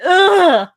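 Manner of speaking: a high, loud grossed-out ugh; mock disgusted ugh. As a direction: groan like a pineapple-pizza hater. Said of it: "half of you going".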